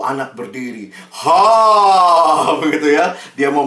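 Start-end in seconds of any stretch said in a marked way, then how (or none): 1.2-2.6 loud imitated "Ha!" roar breaking into a laugh; mock roar. Preacher imitating a boy roaring to show God's greatness, loud.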